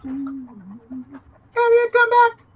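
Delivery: high, loud eerie wail; spooky calling. Pretend. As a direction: call out like a ghost in the dark. Calling a lost friend back.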